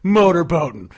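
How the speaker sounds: loud, jeering call-out; a hammed-up shout of the line; mock shouted motorboating. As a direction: imitate the guy yelling it in the song. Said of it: "someone guy just goes".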